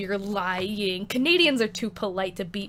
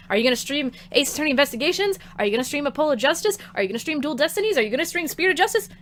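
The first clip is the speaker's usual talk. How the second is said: rapid-fire mock questions, pitch creeping up; imitating pestering viewers; 5 semitones higher, faster than usual. Streamer mimicking chat begging for more games, rapid-fire pestering. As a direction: fire off the questions fast, mimicking nagging fans. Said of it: "When you are like".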